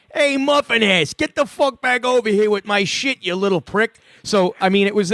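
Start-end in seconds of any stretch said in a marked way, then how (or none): 0.1-4.0 dad yelling. Retold or imitated. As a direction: call the thieving kid back angrily. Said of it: "my father's like".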